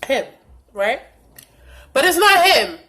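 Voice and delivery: ordinary talk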